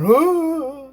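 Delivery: high, mocking hoot; mocking noise. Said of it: "the older guy mocks me".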